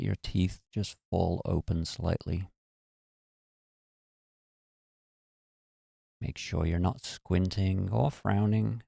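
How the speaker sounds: calm, unhurried meditation voice with long pauses; guided meditation. Acted. Teacher guiding listeners through relaxing their bodies, calm and measured. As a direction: ease them gently down, phrase by phrase.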